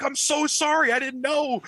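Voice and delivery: high-pitched imitation of upset mom; mock distressed apology, high. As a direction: mimic a mother apologizing, voice way up. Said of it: "she was actually upset".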